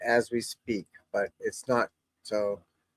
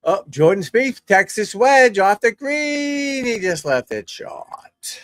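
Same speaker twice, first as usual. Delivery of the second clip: mock commentator; 9 semitones higher, 6 dB louder, slower than usual.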